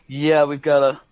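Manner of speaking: usual speaking voice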